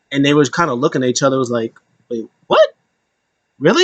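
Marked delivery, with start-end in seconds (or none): 2.1-3.8 a hammed-up double take; mock stunned disbelief. The others hearing Riddle's toe plan, mock stunned disbelief. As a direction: jump way up high, stunned.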